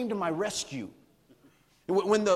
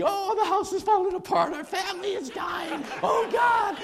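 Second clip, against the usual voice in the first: much higher, louder panicked wail; mother losing it, shrieking in high panic; frantic mom; 13 semitones higher, 4 dB louder than usual. Retold or imitated. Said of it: "my mom's going crazy".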